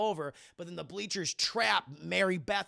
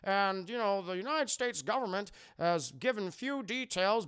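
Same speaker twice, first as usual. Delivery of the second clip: mock newscaster read, a bit higher; news reporter; 4 semitones higher than usual. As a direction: report it like a TV anchor on the scene. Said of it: "we have all these news reporters on the scene".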